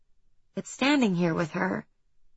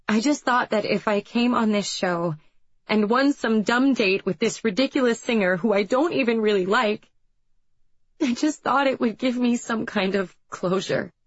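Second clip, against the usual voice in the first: higher, tearful outburst; crying it all out at last; 3 semitones higher than usual. A narrator's reading. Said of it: "finally bursting, tears streaming".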